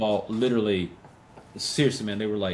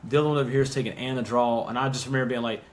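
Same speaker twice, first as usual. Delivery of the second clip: ordinary delivery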